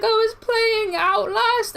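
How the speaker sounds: high, slow and loud, still rattled; shaken high explanation. Retold or imitated. Retelling the panicked night.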